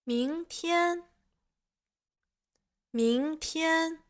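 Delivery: 明 rising into high level 天; second tone. Shown as a demonstration. Teacher giving Chinese examples, rising second tone on 明, high 天. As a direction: say 明天 climbing on 明, then staying high. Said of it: "Chinese words with the second tone".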